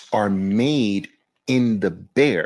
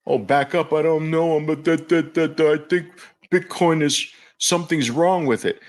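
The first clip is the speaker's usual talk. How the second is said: mock dithering, held on one higher note; worried doubter; 3 semitones higher than usual. Mocking fearful investors who doubt Bitcoin, nervous dither. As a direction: imitate a nervous, babbling doubter. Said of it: "It's not".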